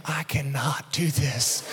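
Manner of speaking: low, soft, slow inner voice; defeated aside, played for comedy; mock despairing thought. Pinned dad thinking to himself, mock quiet despair.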